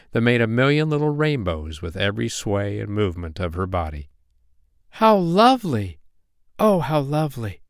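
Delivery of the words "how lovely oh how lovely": high-pitched fairy wonder, easing on the repeat; delighted cry. A narrator's reading. Poppy Pink seeing the glorious wings, delighted cry. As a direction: exclaim it high in admiration. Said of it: "cried Poppy Pink".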